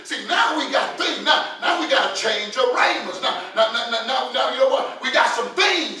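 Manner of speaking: loud, rising pulpit insistence; full-force preaching. Acted. Preacher telling the church they have things, full-force preaching. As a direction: press the point on the congregation, stammering with urgency.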